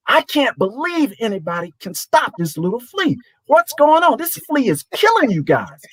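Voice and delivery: high-pitched mock scream; retold coach screaming. Assistant coach screaming at his scholarship players, mock scream. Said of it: "he's screaming at his scholarship players".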